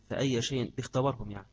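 ordinary talk, nothing marked

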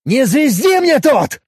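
loud, slow, high angry snap; furious. Acted. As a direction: cut him off in rage. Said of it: "Ещё больше распалился Степанов".

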